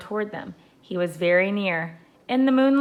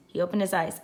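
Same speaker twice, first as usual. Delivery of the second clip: plain reading voice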